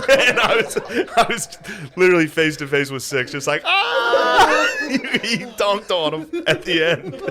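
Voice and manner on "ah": laughing shout of Ah!; a sudden laughing scream right up close; mock yell. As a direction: yell it in his face through a laugh. Yelling in Six's face.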